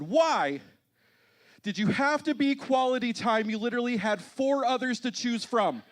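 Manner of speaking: high, loud exasperated shout; retold outburst, shouted high and hard; losing it. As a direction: blurt it out at full volume, fed up. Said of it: "And so I lost it".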